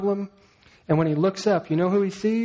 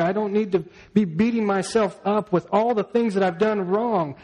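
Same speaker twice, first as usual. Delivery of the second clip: normal talking voice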